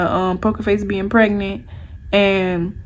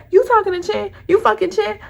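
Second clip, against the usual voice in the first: mad texting; 9 semitones higher than usual. Retold or imitated. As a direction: fire off accusing questions, pitched up. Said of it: "I'm texting him in all caps. I'm mad as fuck".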